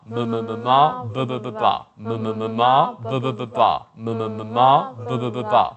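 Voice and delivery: ma ba drill with pencil propping jaw; pencil in mouth. Shown as a demonstration. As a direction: chant ma ma ba ba through a pen. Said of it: "place your pencil in if you're comfortable".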